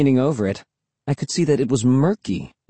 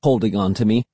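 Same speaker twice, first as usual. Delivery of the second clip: usual speaking voice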